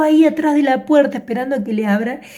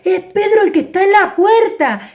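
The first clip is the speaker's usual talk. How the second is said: excited shouting; 8 semitones higher than usual. Acted. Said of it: "empezó a los gritos".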